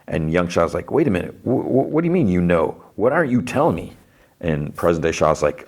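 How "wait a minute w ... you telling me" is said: retold disbelieving demand. Younger Shah demands an explanation.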